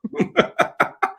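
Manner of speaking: a quick high breathy laugh; high airy laugh. Real, not acted. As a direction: laugh at the confession. Laughs at brogue confession.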